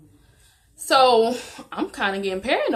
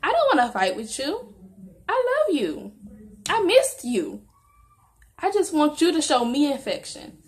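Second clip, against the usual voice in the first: pleading for affection; 5 semitones higher than usual. Retold or imitated. Girlfriend imagining telling boyfriend what she was feeling, pleading and high. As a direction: beg lovingly, pitch lifted. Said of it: "That's what I was feeling".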